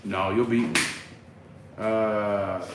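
ordinary delivery

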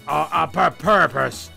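normal talking voice

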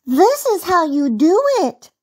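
put-on high, confident child-bunny call; rabbit girl calling back. A narrator's reading. Little rabbit girl showing uncle how, high calling. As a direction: call it back in a small girl's voice. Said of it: "called back the cute little rabbit girl".

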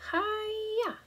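high martial arts yell; kung fu cry. Acted. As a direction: shout it like a karate kick.

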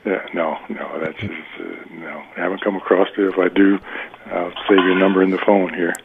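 ordinary talk, nothing marked

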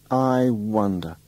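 drawn out slowly, I wonder; slow musing. Acted. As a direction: linger over I wonder, slowly.

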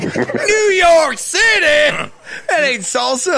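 high, stretched-out cowboy holler; hammed-up commercial outrage; Papa Dave. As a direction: cry it in disbelief like a salsa ad cowboy, half laughing. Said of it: "Papa Dave's on the commercial like".

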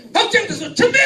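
high, loud call with a laugh; rally them to start; loud cue call. Shown as a demonstration. Pastor leads the congregation's laughing clap, loud cue. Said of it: "박장돼서 같이 하는 거예요".

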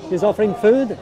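normal talking voice

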